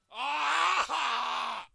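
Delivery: long, loud "aah" shout; real frustrated yell; frustrated scream. Real, not acted.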